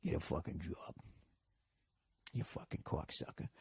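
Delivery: very soft, low mutter; barely voiced grumble under the breath; mumbling. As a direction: mumble it to yourself in a Boston accent. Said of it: "just mumbling".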